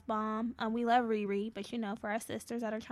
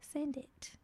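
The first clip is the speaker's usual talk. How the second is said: whisper; 4 semitones higher, 5 dB quieter than usual. Acted. Teasing sign-off to listeners.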